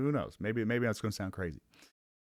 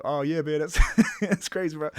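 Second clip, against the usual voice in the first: louder, higher, laughing through the words; imitated laughing reply; 5 semitones higher, 6 dB louder than usual. Friendly guy amused by the joke, imitated laughing reply. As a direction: act out a man cracking up as he answers. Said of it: "he started laughing".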